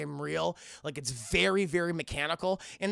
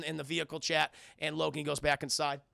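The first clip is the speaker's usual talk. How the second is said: ordinary talk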